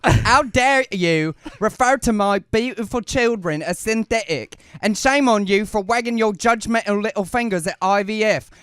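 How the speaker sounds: a hammed-up Cockney flower girl voice, indignant. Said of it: "I'm gonna do [name] as Eliza Doolittle".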